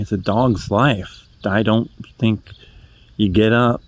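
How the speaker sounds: usual speaking voice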